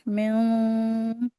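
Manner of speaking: मिन slow, with a held nasal ghunna. Shown as a demonstration. Teacher showing the ghunna on मिन, slow and held. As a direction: hum the नून through the nose on मिन. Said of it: "गुन्ना करके इसको पढ़ेंगे".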